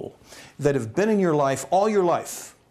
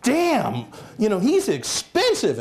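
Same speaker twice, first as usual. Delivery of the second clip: high-pitched retold dismay; hammed-up shock at the price; mock sticker shock; 7 semitones higher than usual. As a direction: balk at the bill, pitch jumping. Retold shock at seeing the bill.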